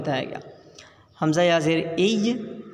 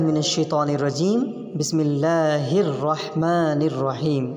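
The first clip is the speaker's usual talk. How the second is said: Quran recitation. Shown as a demonstration. Teacher opening the lesson with bismillah, measured recitation. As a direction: recite it with long held vowels.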